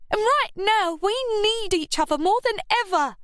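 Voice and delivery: put-on high, insistent young voice; stressed. A narrator's reading. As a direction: press each word, pitched high. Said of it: "she stressed".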